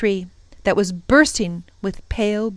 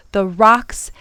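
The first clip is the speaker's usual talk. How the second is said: ordinary delivery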